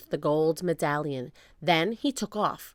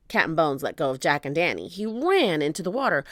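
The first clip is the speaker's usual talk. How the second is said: ordinary delivery, nothing marked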